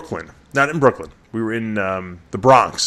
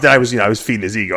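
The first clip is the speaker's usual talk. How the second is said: normal talking voice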